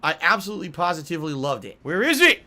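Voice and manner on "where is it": high, loud burst of exasperation; angry demand. Real, not acted. Player still hunting the item, loudly exasperated. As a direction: fire the question out at raised pitch and volume.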